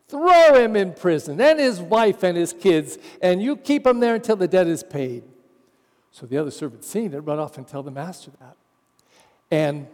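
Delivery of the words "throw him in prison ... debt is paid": loud, harsh order to jail the debtor; angry demand. Acted. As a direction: bark it like a merciless creditor. Angry servant demands the debt.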